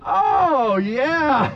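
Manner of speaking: high gloating oh yeah; mock triumph, loud and high; crowing over a crying bully. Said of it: "I was like".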